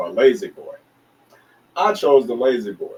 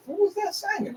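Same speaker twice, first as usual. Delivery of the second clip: much higher, squeaky impression of the promoter; mock high promoter voice; 4 dB quieter than usual. Telling the story of the promoter, mock high voice. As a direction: pitch way up to mimic him.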